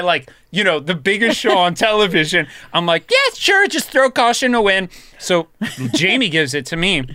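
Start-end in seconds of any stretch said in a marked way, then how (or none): 3.1-4.9 much higher, louder, overeager agreement; squeaky high mock bravado; mock eager yes. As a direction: toss it off like a kid trying to look cool. Retelling his eager reply to peer pressure.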